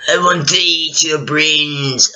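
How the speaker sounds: slow moaning zombie drone; slow mock zombie moan. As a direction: groan it out like a shambling undead. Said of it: "zombies are like".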